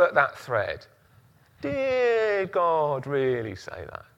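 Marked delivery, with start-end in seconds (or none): 1.6-4.0 sly serpent voice. Pretend. Voicing the devil's doubt.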